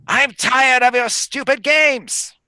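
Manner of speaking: high, slow hag snarl; scratchy hag voice. Acted. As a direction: growl it as an old hag. Hag voice continues.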